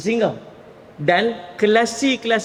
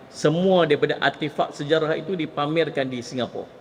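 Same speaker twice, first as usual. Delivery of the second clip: normal talking voice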